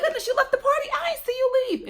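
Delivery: high-pitched mock indignant partygoer; imitating surprised party guests. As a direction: squeal it like an offended fellow guest. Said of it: "And people be like".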